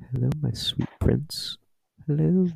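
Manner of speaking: breathy creepy whisper into the ear. Retold or imitated. Friend Noah leaning in to whisper to him, creepy. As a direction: lean in and purr it creepily. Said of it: "lean over to me and go".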